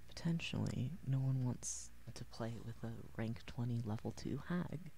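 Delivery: ordinary talk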